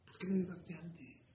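preacher quietly challenging the congregation; soft rebuke. Real, not acted. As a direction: lean in and ask it gently. Preacher asks listeners softly.

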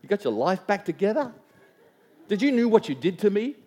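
joking imagined retort to a daughter, mock sarcastic; mock sarcastic reproach. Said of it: "I feel like saying".

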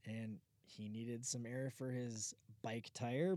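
ordinary talk

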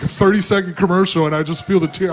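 ordinary talk

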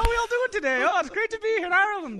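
mock Irish lilt, like a jolly tourist greeting; Irish-accent impression of a president, pitched high. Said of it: "Don't forget about Irish Obama".

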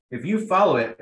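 talking through a mouthful of food; mouth full. Real, not acted. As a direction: speak around a bite of wrap. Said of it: "I have food in my mouth".

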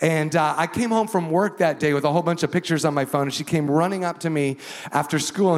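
ordinary talk

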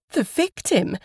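high-pitched, shocked question; startled echo. A narrator's reading. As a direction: catch on the word in alarm. Woman startled by the word victim.

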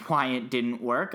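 ordinary delivery

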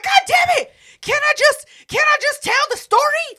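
high, loud mock-exasperated yell; exasperated outburst. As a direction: imitate her fed-up cry to be heard. Exasperated interrupted storyteller, acted out.

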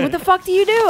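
high-pitched indignant question; retold exasperation. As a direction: act out helpless exasperation. Retells exasperation after apologizing.

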